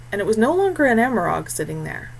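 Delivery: plain reading voice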